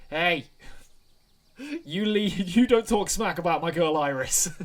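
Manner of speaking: loud, high outburst through laughter; laughing protest. Real, not acted. Player laughingly defends a favourite character, loud laughing protest. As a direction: shout it while cracking up.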